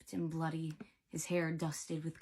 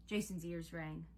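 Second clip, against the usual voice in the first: ordinary delivery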